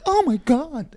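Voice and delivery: high-pitched mock awe; mock amazement. Describing a bouquet's size, high mock amazement. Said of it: "huge, but not like".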